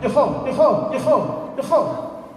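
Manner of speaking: high repeated falling calls; calling out. A narrator's reading. Eye warns as men return to village, high calling. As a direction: keep hollering the high warning. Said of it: "the eye starts calling out".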